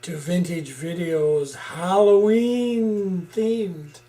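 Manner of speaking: slow, booming horror host welcome. Acted. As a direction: greet the mortals like a creepy horror host. Spooky Halloween show opening.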